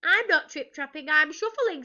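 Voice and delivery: high, small troll voice; little high-pitched protest. A narrator's reading. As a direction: squeak it out meekly. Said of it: "in a very small voice".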